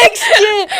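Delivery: loud laughing yell; laughing shout. Real, not acted. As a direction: burst it out with a laugh. Jointly calling out, laughing.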